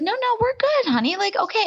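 high-pitched mock soothing; imitated reassurance. Host quotes a man calming his upset girlfriend, high mock reassurance. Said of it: "He's like".

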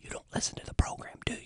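a hushed, unvoiced jab; whispered aside. Host mutters back at the letter writer, whispered. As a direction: whisper it under the breath.

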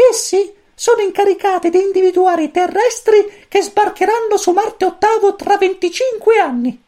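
very high, squeaky little chick voice; cosmic chick voice. A narrator's reading. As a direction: chirp it like a tiny alien chick. Pulcino cosmico parla. (vocina acutissima da pulcino)

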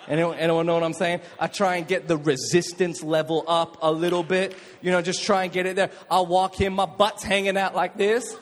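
usual speaking voice

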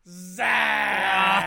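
shouted drawn-out name; hype announcer. Acted. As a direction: announce the co-host like a crowd. Hyped co-host intro.

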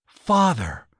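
sudden shocked cry; gasped. A narrator's reading. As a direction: gasp it out in dismay. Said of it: "gasped Lucille".

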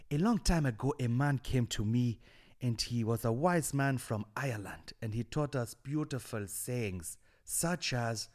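storyteller in a put-on African accent; muddled African-Jamaican accent; attempted African accent, drifting Jamaican. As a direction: recall the wise man in a mock African accent. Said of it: "that was Jamaican".

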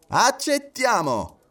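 high, slow, ringing reply; emphatic acceptance. A narrator's reading. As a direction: declare it firmly, stretched out. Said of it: "risposero Stewart". (accettazione decisa)

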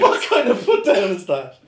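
loud, high, slow laughing protest; incredulous laughter. Real, not acted. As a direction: crack up while asking it. Cracking up at a joke.

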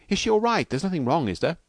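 high, worried questioning; anxious questioning. A narrator's reading. Husband asking doctor about his wife, anxious. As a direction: start high and press for reassurance.

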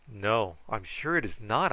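usual speaking voice

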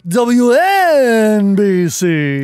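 slow, stretched radio-station ID; Howard Stern impression. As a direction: drag each letter out like a DJ. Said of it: "Can you do it?".

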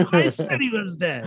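lightly hammed-up retold gloating; mock vindication. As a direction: act out her high-pitched triumph. Retelling her triumphant reaction at ending.

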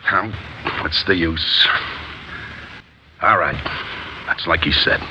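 low, quiet, beaten; all the fight drained out of him; defeated resignation. Acted. Alonzo gives up, defeated.

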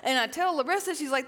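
normal talking voice

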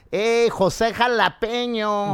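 hammed-up Mexican stand-up accent; comic's exaggerated Mexican accent, high and stretched; mock Mexican accent. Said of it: "comic doing the Mexican voice".

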